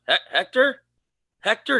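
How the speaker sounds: hesitant, rising call to a dog; cautious, questioning dog call; tentative calling. Retold or imitated. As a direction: stammer the name, then lift it high as a question.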